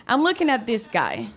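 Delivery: ordinary delivery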